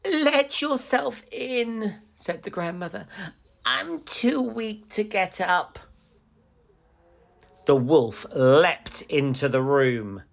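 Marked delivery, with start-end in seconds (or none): 0.0-5.8 weak grandmother. Acted. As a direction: sound too weak to rise.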